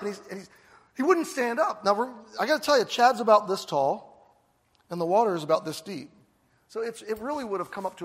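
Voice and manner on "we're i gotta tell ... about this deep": ordinary talk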